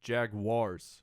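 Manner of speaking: "Jaguars" said as two syllables, JAG-wars; pronunciation demo. Shown as a demonstration. Co-host teaching the host a team name, demonstrated pronunciation. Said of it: "teach me how to pronounce".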